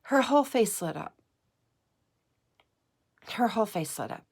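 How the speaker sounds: crying. Real, not acted. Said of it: "Sorry if I'm crying".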